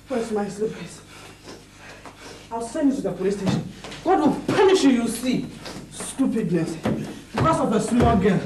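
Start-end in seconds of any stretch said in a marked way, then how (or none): 4.0-4.9 high-pitched angry curse; indignant, high and quick to condemn. Acted. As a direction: hurl a curse in a raised pitch. Cursing in a quarrel.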